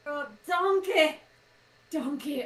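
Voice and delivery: put-on warming up a Scottish accent, half-landed; Scottish accent attempt. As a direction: try the Scottish accent on "donkey". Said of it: "why can't I do the fucking Scottish accent".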